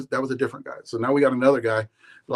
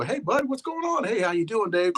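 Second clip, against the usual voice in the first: high-pitched, breezy hello; mock-friendly greeting; 10 semitones higher than usual. Bat maker greeting his badmouther at the tent, mock-friendly. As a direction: greet him in an exaggerated high voice.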